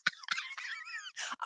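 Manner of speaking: a sudden high-pitched burst of laughter; high squeaky laugh. Real, not acted. Medium laughing at the big noses remark, high squeaky giggle. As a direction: squeal a laugh, then let it drop away. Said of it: "I'm not laughing at your nose".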